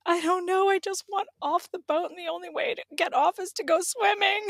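high, strained, near tears; retelling her upset plea to husband; tearful plea. Retold or imitated. Retelling to her husband, high and near tears. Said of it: "And I was crying".

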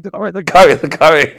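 calm the owner in a panic; nervous insisting. Retold or imitated. Teen reassuring worried owner.

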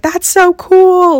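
high, loud, drawn-out squeal of delight; gushing friend. Retold or imitated. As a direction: stretch it out like a thrilled best friend. Best friend voiced hugging fan.

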